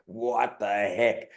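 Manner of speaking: slow, drawn-out amazement at the packed stadium; stunned. Retold or imitated. Player walking out to bagpipes, drawn-out awe. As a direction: let it hang in disbelief. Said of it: "and you're like".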